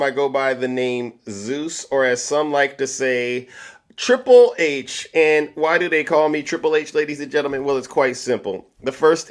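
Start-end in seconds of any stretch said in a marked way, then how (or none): 4.0-5.1 hype announcement. Real, not acted. Announcing his own nickname.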